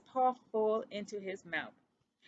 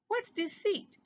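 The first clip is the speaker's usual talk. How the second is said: much higher, curious little question; Ced asking what the word means, curious and much higher; 12 semitones higher than usual. Acted. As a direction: innocent puzzlement. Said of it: "asked Ced curiously".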